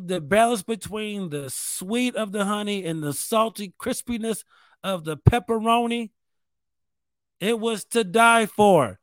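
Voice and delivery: ordinary delivery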